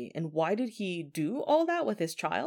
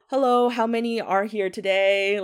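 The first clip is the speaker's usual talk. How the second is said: loud, higher mock greeting; joking call; 4 semitones higher, 5 dB louder than usual. Father knocking on the mirror for ghosts, joking call. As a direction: playfully call out to unseen guests. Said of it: "just joking around still".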